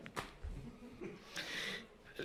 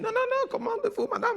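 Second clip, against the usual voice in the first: much higher, mock foreign waiter voice; imitating the waiter. The waiter insisting to the customer, mock high accented voice.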